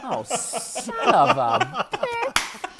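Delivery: high, drawn-out mock exasperation; comic outrage. Patient learns it was only a doctor, comic outrage. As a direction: stretch out the indignant curse. Retold outrage at being fooled.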